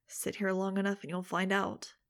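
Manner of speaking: ordinary delivery, nothing marked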